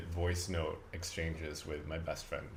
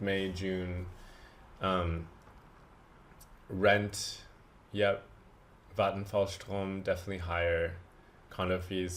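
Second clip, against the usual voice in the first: ordinary talk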